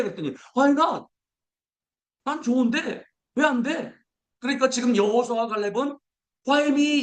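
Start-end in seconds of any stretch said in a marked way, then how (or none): 0.6-3.9 high keen reply; eager why not. Pretend. Voicing eager acceptance. 6.5-7.0 high whiny why me; high plaintive why me; complaining why me. Pretend. Contrasted with Why not.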